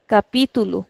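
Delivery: "Capítulo" with a long "pí"; stretched accented syllable. Shown as a demonstration. Teacher repeating the accented word for the class, stretched on PÍ. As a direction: draw out the PÍ in "Capítulo". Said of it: "the sound is changing completely".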